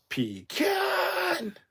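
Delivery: high, drawn-out yell on the last syllable; hammed-up high holler; mock shout. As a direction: belt out "Pecan!", stretching the CAN. Shouts a viewer's typed word.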